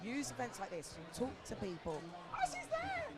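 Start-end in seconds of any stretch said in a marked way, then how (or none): 2.3-3.0 high, laughing excitement at spotting her boss; shrieking with delighted surprise; excited squeal. Real, not acted.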